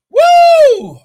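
very loud, high "woo"; exultant whoop. Real, not acted. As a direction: belt out a high preacher's whoop. Preacher whoops mid-sermon.

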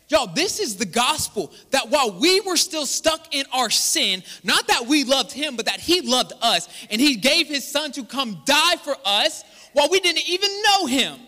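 loud, high, slow proclamation; full-force preaching. Real, not acted. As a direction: build to a ringing pulpit climax.

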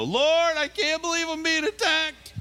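very high-pitched, hammed-up cry of complaint; mock wail. A driver wailing to the Lord over a blown motor, hammed-up complaint. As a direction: squeal it out loud like an overdramatic victim.

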